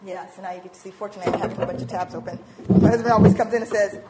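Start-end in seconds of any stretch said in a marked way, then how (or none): none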